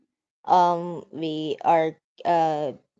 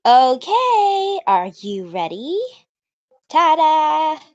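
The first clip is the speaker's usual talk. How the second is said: high, loud showy reveal; proud girl voice; 9 semitones higher, 9 dB louder, much slower than usual. Acted. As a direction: present it proudly. Natsuki presenting cupcakes.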